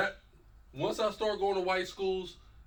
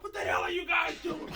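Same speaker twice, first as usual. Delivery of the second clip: high-pitched mock owner's outburst; retold indignant yelp, pitched high; scolding team owner. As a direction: imitate an owner calling out his rallying team. Team owner scolding players, acted.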